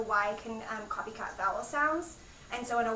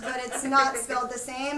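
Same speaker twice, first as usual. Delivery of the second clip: louder mock explaining; acted teacher reply; 2 semitones higher, 7 dB louder than usual. Teacher retelling how she corrected a pupil, louder acted reply. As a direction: correct a pupil, playing it up for adults. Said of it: "I was like".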